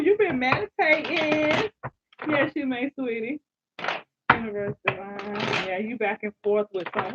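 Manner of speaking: normal talking voice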